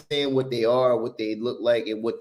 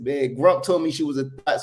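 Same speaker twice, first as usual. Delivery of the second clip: normal talking voice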